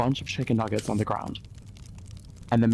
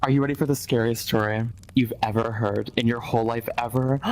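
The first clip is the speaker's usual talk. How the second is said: usual speaking voice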